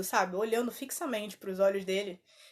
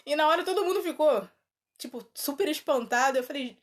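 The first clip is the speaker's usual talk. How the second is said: ordinary talk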